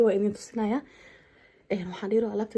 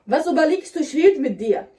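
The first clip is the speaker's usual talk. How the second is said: sharp demand, higher and louder; angry; 5 semitones higher, 7 dB louder than usual. Pretend. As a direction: snap at him to answer. Staged quarrel with husband.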